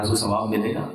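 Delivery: normal talking voice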